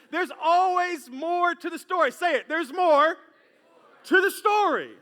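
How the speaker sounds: loud, high, emphatic proclamation; rallying preacher's shout. Real, not acted. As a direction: drive the key line home to the congregation. Preacher rallies congregation to repeat.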